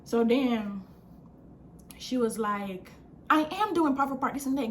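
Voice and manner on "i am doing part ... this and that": higher-pitched mock protest; imitated getting mad. Storyteller replays the woman's defense, mock mad. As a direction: mimic her getting worked up. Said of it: "getting mad".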